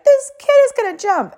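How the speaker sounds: high, alarmed mom imitation; mock freak-out. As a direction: pitch it way up, panicky bystander. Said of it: "she's, like, freaking out".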